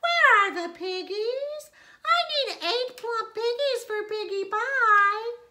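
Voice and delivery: high, loud bossy witch voice; demanding witch. A narrator's reading. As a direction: bark orders like a greedy witch.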